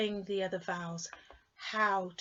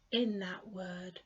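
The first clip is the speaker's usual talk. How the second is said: ordinary delivery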